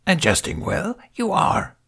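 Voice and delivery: put-on high, breathy little old master; lightly voiced Yoda. A narrator's reading. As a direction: croak it out like a tiny ancient sage. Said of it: "the Grandmaster said".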